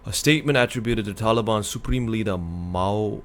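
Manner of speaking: reading the headline aloud; news article read. Acted. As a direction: read it out like a report.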